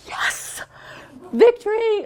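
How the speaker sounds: breathy yes, then high loud victory; gleeful re-enacted victory cry; triumphant celebration. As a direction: crow it like a hard-won win. Acts out delight at hostile note.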